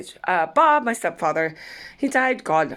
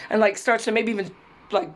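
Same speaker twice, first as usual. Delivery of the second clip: ordinary delivery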